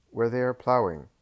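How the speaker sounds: lie it away with a shrug in the voice; evasive landowner. A narrator's reading. Doris answers evasively.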